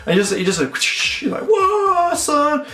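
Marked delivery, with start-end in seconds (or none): 1.5-2.6 high, drawn-out mock taunt. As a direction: stretch it out in a high, goading voice. Mock reaction to emperor's blow.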